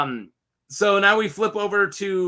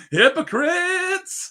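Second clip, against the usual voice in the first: high, drawn-out playful holler; mock accusing cry; 12 semitones higher, much slower than usual. Reviewer accusing both secret lovers, mock outraged high cry.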